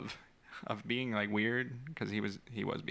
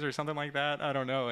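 usual speaking voice

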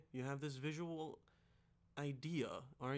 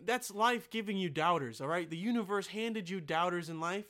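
ordinary talk, nothing marked

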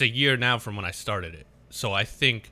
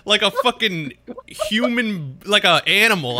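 laughing exasperation; 8 semitones higher, 6 dB louder than usual. Real, not acted. Cohost retelling driving to the ATM, laughing in exasperation. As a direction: crack up at how absurd it was.

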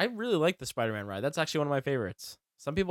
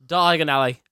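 louder, punched-out "Diagon Alley"; emphatic correction; 3 semitones higher, 10 dB louder than usual. Real, not acted. Co-host correcting a misheard place name, emphatic. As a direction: say "Diagon Alley" loud and distinct.

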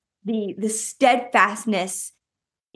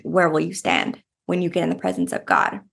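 ordinary delivery

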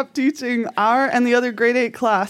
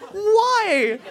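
much higher incredulous why; exasperated why; 10 semitones higher than usual. Retold or imitated. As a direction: throw up your hands at math. Complaining to math.

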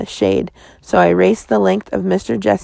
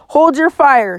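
bellow; 6 semitones higher than usual. A narrator's reading. Sheriff bellowing at his deputies to hold fire. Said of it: "Star bellowed".

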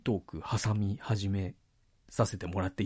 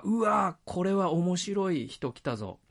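lightly voiced, higher-pitched spectator reaction; a touch of mock excitement, pitched up; imitated excited audience; 7 semitones higher than usual. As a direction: mimic an audience member's keen anticipation. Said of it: "観客は".

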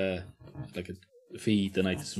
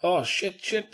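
mock surprise; 10 semitones higher, slower than usual.

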